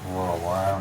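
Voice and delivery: ordinary delivery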